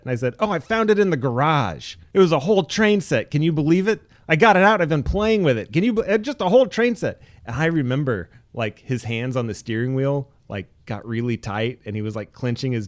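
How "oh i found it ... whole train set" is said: put-on high, loud gushing kid bragging; over-the-top childlike excitement; excited kid. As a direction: gush about the toy, pitched up and loud. Kid brags to dad about set.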